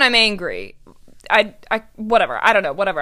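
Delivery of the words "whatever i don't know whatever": normal talking voice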